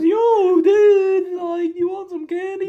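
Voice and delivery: squeaky, loud cartoon dog voice; high-pitched cartoon dog. Cartoon dog offering candy, high-pitched. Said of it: "a dog that talks in a high-pitched voice".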